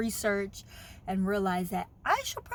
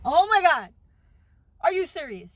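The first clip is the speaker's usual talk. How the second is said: shocked disbelief; 8 semitones higher, 10 dB louder than usual. Retold or imitated. Shocked by doctor's news.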